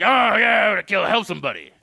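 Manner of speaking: loud roar tumbling into a rush; mock aggression. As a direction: play an over-eager, aggressive helper. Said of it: "It's the aggression".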